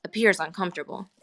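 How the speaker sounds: usual speaking voice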